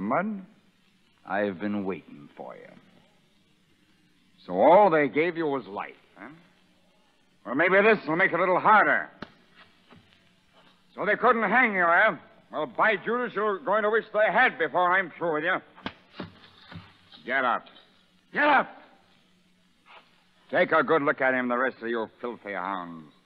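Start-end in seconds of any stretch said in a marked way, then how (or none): 4.4-15.6 loud, higher, taunting snarl; a brutal prison sergeant mocking the new convict; sneering bully. 18.3-18.7 much higher, louder bellowed order; furious shout. Acted. Sergeant orders prisoner up.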